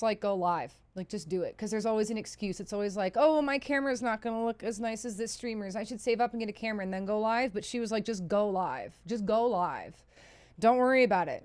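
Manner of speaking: usual speaking voice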